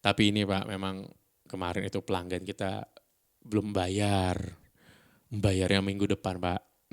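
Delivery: very slow, calm explaining; unhurried midnight-DJ negotiation; slowed-down intonation. Shown as a demonstration. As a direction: draw the words out patiently for the landlord. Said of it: "dipelanin intonasinya".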